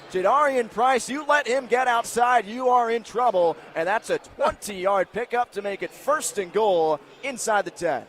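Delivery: energetic live football call; excited play-by-play. Real, not acted. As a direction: punch out each yard marker excitedly. Live big-run call.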